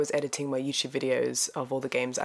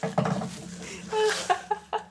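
high unvoiced overwhelmed giggle; breathless giggle. Real, not acted. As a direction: let out an airy, helpless laugh. Laughing at the gift.

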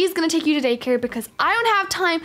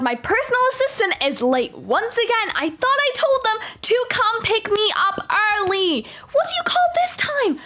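high-pitched bratty outrage; hammed-up exasperation; indignant scolding; 6 semitones higher than usual. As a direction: complain like a put-out diva, pitch climbing. Scolding the late assistant.

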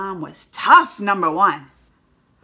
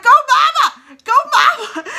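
much higher, loud cheering laugh; 10 dB louder than usual. Real, not acted. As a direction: cheer her on with a laughing shout. Cheering on the mom.

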